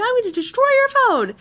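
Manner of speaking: high-pitched mock threat; imitating angry brother. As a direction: threaten like a brother at his limit. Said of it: "He's getting really, really angry".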